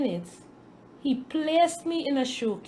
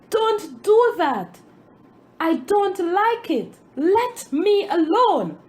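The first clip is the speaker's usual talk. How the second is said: yelled out; 8 semitones higher, 6 dB louder than usual. A narrator's reading. Grain of wheat yelling at its master, loud high protest. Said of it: "I yelled out".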